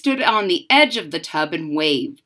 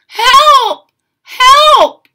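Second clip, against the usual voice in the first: high, loud shouts for help; desperate cry for help; 13 dB louder than usual. Acted. Tub child on the whirlpool calling out, loud desperate cry. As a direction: scream for help while spinning down.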